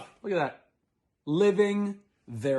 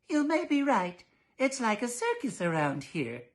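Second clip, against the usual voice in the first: higher, fussy wife agreeing; prim mother voice; 9 semitones higher than usual. Acted. Mrs Dollard voiced.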